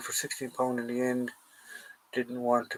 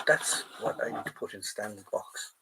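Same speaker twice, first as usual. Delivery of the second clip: ordinary talk, nothing marked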